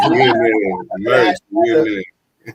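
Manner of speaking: laughing hype, shouted out; loud laughing shout. Real, not acted. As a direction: shout it through big laughter. Hyped shout-out laughing loudly.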